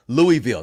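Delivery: Louisville as LOO-ee-vill, three syllables; outsider's pronunciation. Real, not acted. Host greets a viewer city, Louisville said LOO-ee-vill. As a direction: say Louisville with a clear "ee" in the middle. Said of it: "They don't say Louisville".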